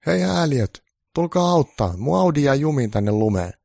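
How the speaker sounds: hyvin korkea, kova vihainen huuto; ärtynyt huuto. A narrator's reading. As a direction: huuda ärtyneenä apua. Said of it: "Ärtynyt mies huusi".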